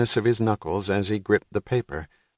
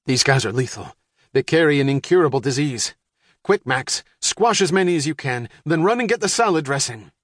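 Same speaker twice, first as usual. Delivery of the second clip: a lightly voiced father, urgent and higher; Dad barking urgent orders about the beetles; 5 semitones higher than usual. A narrator's reading. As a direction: hurry the family as the alarmed dad.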